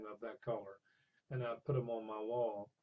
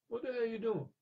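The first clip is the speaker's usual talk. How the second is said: gruff trucker; 10 semitones higher than usual. Retold or imitated. Big truck driver confronts blind teller.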